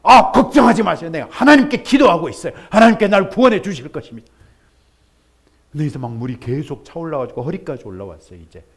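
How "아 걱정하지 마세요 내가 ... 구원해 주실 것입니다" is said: loud, fast, self-assured brush-off; confident refusal. Acted. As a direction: wave off the rescue smugly. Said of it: "역시".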